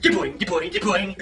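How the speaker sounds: low bouncing sound effect; a hint of bouncy Tigger, low; lightly voiced Tigger. Tigger bounces up to his friends, lightly bouncy. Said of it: "Tigger came bouncing up and said".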